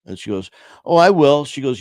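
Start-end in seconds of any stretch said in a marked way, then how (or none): 0.8-1.5 high, loud imitation of a breezy mom; reply like a mother who suspects nothing, loudly and up high; imitated carefree mom, pitch jumping up; oblivious mom. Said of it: "She has no idea what's going on".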